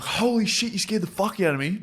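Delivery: high, loud, startled shout; startled yelp. Retold or imitated. Mate scared by a girl hiding behind door, startled high yelp. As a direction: yelp like you just got jumped. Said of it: "And he was like".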